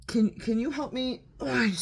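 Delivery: squeaky high begging; high pleading voice. Real, not acted. Pleading for help.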